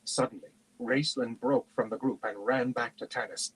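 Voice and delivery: ordinary delivery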